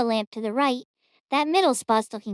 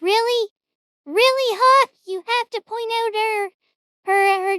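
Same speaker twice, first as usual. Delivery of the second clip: surprised; 9 semitones higher, 4 dB louder than usual. Real, not acted. Shocked reaction to a clip.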